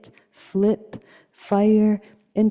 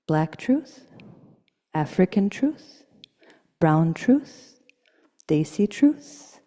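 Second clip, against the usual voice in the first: a rising litany, lightly lower; poem litany; 3 semitones lower than usual. Acted. Poet chanting truths to audience, litany.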